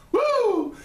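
loud high whoop of delight; excited woo. Real, not acted. Reacting to the second girl's picture, excited whoop. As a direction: whoop at the photo.

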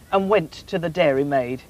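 ordinary delivery

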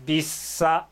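びっさ said with a tense ss; Korean word demo. Shown as a demonstration. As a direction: read びっさ with a tight double s. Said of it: "ここにあー母音を入れます". (韓国語の発音で)

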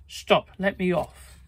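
panicked cry to stop; frightened scream. A narrator's reading. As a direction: yell out in fright. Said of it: "The terrified thief screamed".

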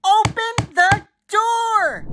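put-on high, drawn-out furious child demand; angry yelling. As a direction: yell slowly through a shut door, word by word. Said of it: "and I am yelling".

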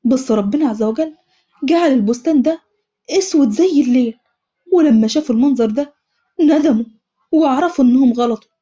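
plain reading voice

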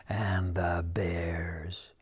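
slow, soft whispered dread; hushed dread. A narrator's reading. Narrator whispers the scariest creature to children. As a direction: drag it out in a breathless whisper. Fearful list ends.

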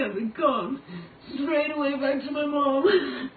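real tears welling up as she speaks; crying. Real, not acted. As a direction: voice breaking into tears, slow. Breaking down over her loneliness.

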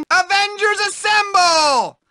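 high heroic shout; rallying cry. Acted. Nerd calls friends to help, high heroic shout. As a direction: shout it like a superhero.

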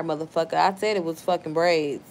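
ordinary talk

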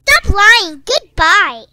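loud, disgusted brush-off; angry dismissal. Acted. Friend leaves Maddie in disgust. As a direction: storm off with it.

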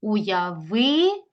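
"уявити" as уяви, a long gap, then ти; divided for hyphenation. Shown as a demonstration. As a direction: hold the ви of "уявити", pause before ти. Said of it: "Могли б перенести або".

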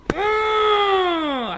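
long, high, loud strained hum; effortful held groan; straining grunt. Real, not acted. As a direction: strain hard through a drawn-out "mm". Straining to grab a hold.

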